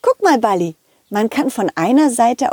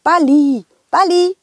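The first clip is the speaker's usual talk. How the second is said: high, louder call; 7 semitones higher, 4 dB louder than usual. Acted. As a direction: call out to the ball. Calling the ball.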